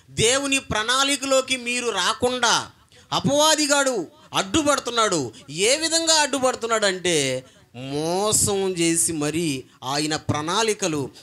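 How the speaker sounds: ordinary talk, nothing marked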